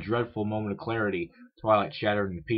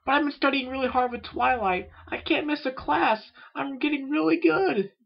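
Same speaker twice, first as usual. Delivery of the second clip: very high, squeaky insistence; young filly, pleading; 4 dB louder than usual. A narrator's reading. Filly insisting to her sister she can't miss class, high pleading. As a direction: protest in a tiny high-pitched voice.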